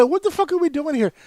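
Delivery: high-pitched retold exasperation; exasperated. Customer to support agent who keeps rejecting answers, exasperated. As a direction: demand it, voice jumping way up.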